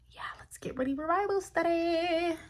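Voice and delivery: breathy unvoiced whisper; a quick whispered confession before Bible study. Real, not acted. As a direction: hush it, just breath, no voice.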